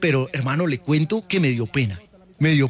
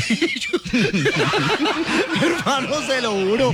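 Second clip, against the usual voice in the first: high laughing swear; laughing hard while swearing it's true; 8 semitones higher than usual. Real, not acted. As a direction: laugh through the words. Laughing retelling.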